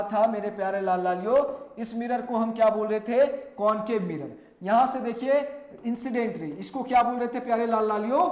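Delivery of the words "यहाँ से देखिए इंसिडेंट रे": ordinary delivery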